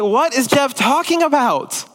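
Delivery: high, drawn-out puzzled question; hammed-up utter confusion; mock bewilderment. Congregation wondering about the preacher's shrimp talk, bewildered.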